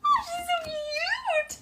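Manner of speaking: very high, squealing baby cartoon voice; cute baby voice squealing with delight; baby Pan voice. Shown as a demonstration. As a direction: gush like a tiny cartoon baby over her cheeks. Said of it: "so here you go, Susan".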